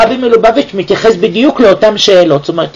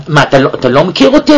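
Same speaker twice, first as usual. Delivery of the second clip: ordinary talk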